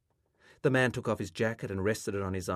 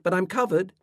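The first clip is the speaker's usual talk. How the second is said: loud, high-pitched reply; shouting back; 10 semitones higher, 7 dB louder than usual. A narrator's reading. Boy assuring his mother he's decent, shouting back. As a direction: call it back in self-defence. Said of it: "he shouted back".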